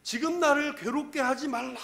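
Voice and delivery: high-pitched anguished plea, trailing into strain; tormented demon's cry. Acted. Demon begs Jesus to leave it alone, agonised cry. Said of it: "이 괴악스러운 소리를 막 질러댔을".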